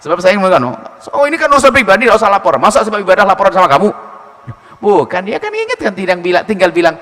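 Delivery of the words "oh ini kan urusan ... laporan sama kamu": higher, loud dismissive retort; scornful husband. Acted. A husband dismisses his wife's reminder, scornful. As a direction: throw it back scornfully.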